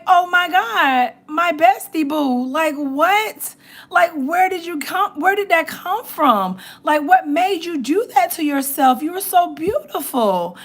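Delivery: imitating herself near tears over her bestie; mock heartbroken wail; retold tearful dismay. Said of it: "I wanted to cry".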